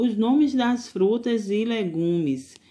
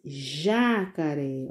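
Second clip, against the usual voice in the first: jacaré stretched out slowly; slow, drawn out; 3 semitones lower, much slower than usual. Shown as a demonstration. Teacher to pupils, naming the picture slowly. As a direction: spell it out slowly. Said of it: "Que nome é esse".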